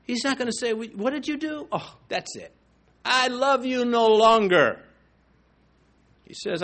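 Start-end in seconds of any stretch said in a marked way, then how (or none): 0.7-4.8 higher, louder, slowing on "I love you no longer"; mock rejecting God. Preacher mocks a God who drops his love, hammed-up. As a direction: play the God he says God is not.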